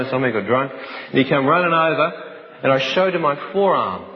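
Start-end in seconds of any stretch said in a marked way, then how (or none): none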